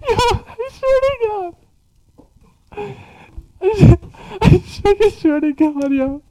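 high, wheezy laughing oath; trying not to laugh. Real, not acted. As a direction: fight back laughter, squeaking.

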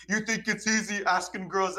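high-pitched retold indignation; mad friend. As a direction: mimic an offended friend, pitch up. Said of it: "He got so mad".

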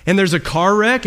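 ordinary talk, nothing marked

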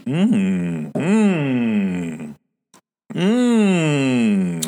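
rising pleased mm after a sip; savoring hum. Real, not acted. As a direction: hum it like the coffee is delicious. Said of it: "That's some good coffee".

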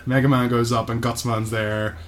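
ordinary talk

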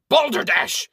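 loud high barked exclamation; angry bark. Acted. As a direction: bark it out in impatience. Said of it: "the Baron barks out".

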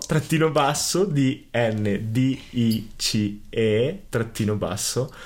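slow spelling again, letters drawn out; dictating. Shown as a demonstration. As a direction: spell each letter slowly for listeners. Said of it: "lo ripeto".

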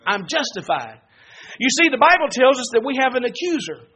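ordinary talk

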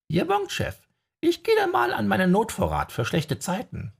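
very high comic sidekick. Acted. Archie signing off to his boss, high comic voice. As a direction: squeak it out high.

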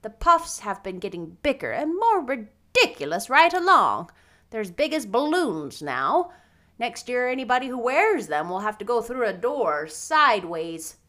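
brisk, swooping scornful delivery; disapproving older character. Acted. Grumbling about ridiculous puffed sleeves, scornful. Marilla's dialogue in the novel.

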